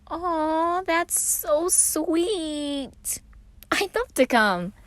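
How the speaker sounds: high, drawn-out aw; sweet Patton. A narrator's reading. Patton touched by the invitation, high and slow. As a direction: coo it slowly, way up high.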